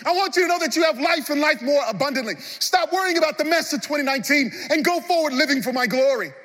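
high, loud, building preacher voice as Jesus; full-force preaching. Acted. Jesus pressing Peter and the church, full-force preaching.

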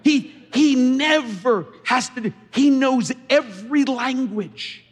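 loud, high, fervent sermon voice; full-force preaching. Acted. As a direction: preach it out with everything.

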